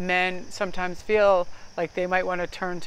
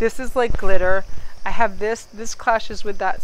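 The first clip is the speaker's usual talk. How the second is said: louder, gushing over sparkly clothes; excited about glitter; 10 dB louder than usual. Real, not acted. Vlogger showing glittery clothes to viewers, excited and loud. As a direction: show off the outfit, thrilled. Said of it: "Look at this".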